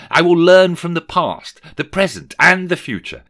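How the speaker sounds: loud, high declamation; fervent vow. Acted. Scrooge pledging to the spirit, fervent. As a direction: proclaim each item as a solemn promise.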